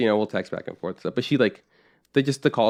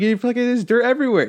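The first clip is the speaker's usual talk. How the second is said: much higher, put-on voice of disbelief; mock exasperated voice; 13 semitones higher, 4 dB louder than usual. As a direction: squeal it in mock horror.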